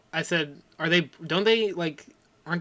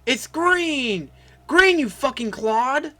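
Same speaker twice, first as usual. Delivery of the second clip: imitating Zeke yelling; 7 semitones higher, much slower than usual. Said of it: "He yells".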